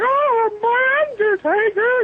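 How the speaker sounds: very high, slow, stretched-out wail; hammed-up ghoulish falsetto; imitated catchphrase voice. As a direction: wail it out theatrically, long and high. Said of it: "standing there in the middle of Walmart going".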